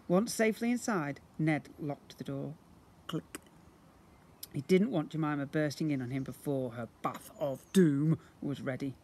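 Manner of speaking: usual speaking voice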